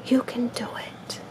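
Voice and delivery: soft unvoiced whisper. A narrator's reading. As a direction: breathe it out as a secret hope. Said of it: "Luke whispered".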